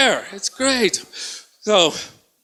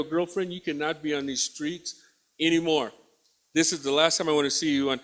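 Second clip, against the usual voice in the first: retold officer, lower and softer; stern warning; 6 semitones lower, 8 dB quieter than usual. Officer warning a woman off the streets, quietly stern.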